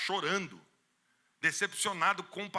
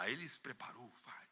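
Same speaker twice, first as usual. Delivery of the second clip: ordinary talk, nothing marked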